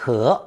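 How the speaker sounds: HE with a rising second tone. Shown as a demonstration. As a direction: say "he" with the pitch climbing, like a question. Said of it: "pronounced second tone".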